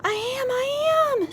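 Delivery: very high, slow plea; longing cry. A narrator's reading. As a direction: wail it out, desperate to be heard. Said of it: "Sylvester wanted to shout".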